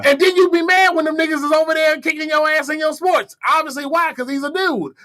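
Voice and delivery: high-pitched indignant tirade; exasperated rant. Acted. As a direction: rant high in mock outrage. Indignant rant at imagined critics.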